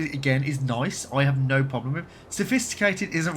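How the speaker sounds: ordinary delivery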